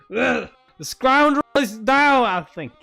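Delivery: slow, loud grand proclamation; pompous announcement. Acted. An official proclaiming the scoundrel beaten, slow and loud. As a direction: announce it like a herald.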